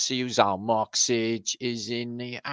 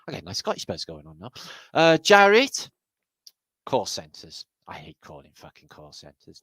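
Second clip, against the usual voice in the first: ordinary talk, nothing marked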